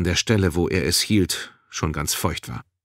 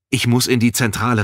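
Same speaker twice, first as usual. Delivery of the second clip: raised, louder plea; urgent call; 5 semitones higher, 5 dB louder than usual. A narrator's reading. Balin to guard blocking him, urgent call. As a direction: call out, pressing to get through. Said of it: "rief Balin".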